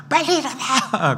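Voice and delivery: high yell cracking into a laugh; mock shout to get up, laughing; imitating mom's yell. Preacher imitating his wife yelling at the boys, laughing. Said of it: "소리 잘 지릅니다".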